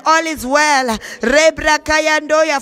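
ordinary talk, nothing marked

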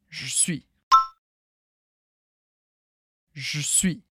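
"Je suis" shortened to "j'suis"; relaxed everyday "je suis"; informal clipped. Shown as a demonstration. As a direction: drop the E, say it fast. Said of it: "de maneira mais informal".

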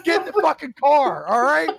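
high, loud outraged yell; retold scream. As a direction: scream at him to get moving. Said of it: "I was screaming".

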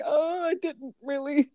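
modest denial. Retold or imitated. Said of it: "I'm like".

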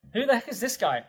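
high, startled disbelief; shocked at himself. Retold or imitated. Startled by his own bold prayer, he asks in high disbelief. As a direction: squeak it out like you caught yourself off guard.

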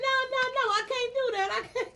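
high-pitched, whiny retold crying; mock crying. Wife retelling a tearful call to husband, mock crying. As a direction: imitate yourself crying, pleading helplessly. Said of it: "I was in my car crying".

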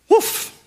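short, high imitated bark; dog's woof. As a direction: give a quick high-pitched woof. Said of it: "says the dog".

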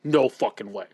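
imitating a friend's disbelief, low; mock disbelief. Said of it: "You were like".

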